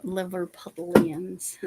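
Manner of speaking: Liverpudlians said slowly, sounded out with care. Shown as a demonstration. Asking Stacy how it's said, slowly sounding it out. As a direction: sound out Liverpudlians slowly, testing each syllable. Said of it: "how do you pronounce that".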